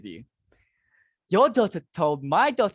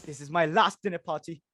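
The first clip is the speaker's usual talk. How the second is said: ordinary talk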